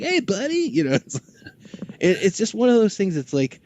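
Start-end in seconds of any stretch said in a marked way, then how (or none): 0.0-0.7 Townsend greeting. Retold or imitated. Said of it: "He's like".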